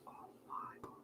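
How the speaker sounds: breathless whisper of awe; real hushed gasp of a whisper; awed whisper. Real, not acted. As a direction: whisper it, overwhelmed. Awed reaction to the duet.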